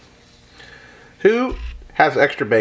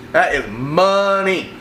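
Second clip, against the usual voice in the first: savoring; 5 semitones higher, much slower than usual. Real, not acted. Savoring the taste.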